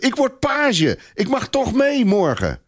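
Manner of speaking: high, eager cry of delight; can't contain the good news; overjoyed boy. A narrator's reading. Boy shouting his happy news.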